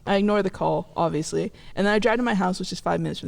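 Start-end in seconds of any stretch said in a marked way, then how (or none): none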